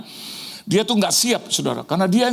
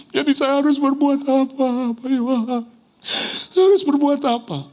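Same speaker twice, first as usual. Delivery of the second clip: pleading senior pastor; 11 semitones higher than usual. Retold or imitated. Troubled senior pastor asking his young pastor what to do, high and pleading. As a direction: beg for an answer, at a loss.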